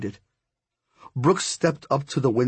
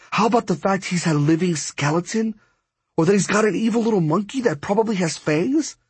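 ordinary delivery, nothing marked

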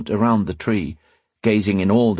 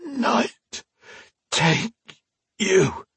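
higher, strained croak through a ruined throat; raw-throated curse; 12 semitones higher, much slower than usual. A narrator's reading. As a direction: squeeze the curse out past swollen throat. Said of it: "past his raw, swollen throat".

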